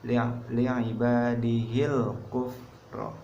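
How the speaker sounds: slow chanted recitation of li'ibadihil kufro, lam said clearly; Quran recitation, clear lam. Shown as a demonstration. Teacher demonstrates to students, slow Quranic recitation. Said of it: "Seperti ini contohnya".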